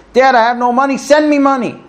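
high, loud, bossy demand. Retold or imitated. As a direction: bark it like a spoiled kid. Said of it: "One neighbor reads it this way".

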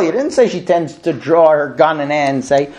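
ordinary talk

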